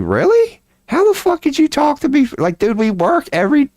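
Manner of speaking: floored that nobody noticed; stunned disbelief. Retold or imitated. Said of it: "I was like".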